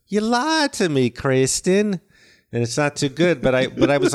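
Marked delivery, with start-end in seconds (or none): 0.1-2.0 high-pitched Alabama twang, imitating Heath; Southern accent. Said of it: "one time he goes".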